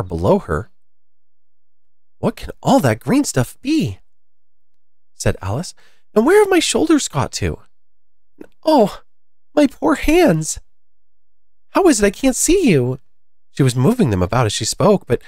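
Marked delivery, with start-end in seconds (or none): 2.2-4.0 high-pitched alarmed questioning; mild storybook alarm, pitched up. A narrator's reading. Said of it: "which changed into alarm". 6.1-13.0 high, plaintive storybook alarm; high-pitched dismay, acted for a story. A narrator's reading. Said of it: "which changed into alarm".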